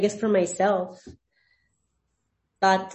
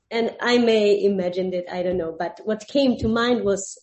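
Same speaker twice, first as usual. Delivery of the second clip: ordinary delivery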